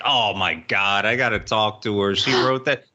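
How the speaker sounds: husband imitated, lightly stunned; mock realization. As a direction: play him amazed she wrote it. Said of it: "he'd be like".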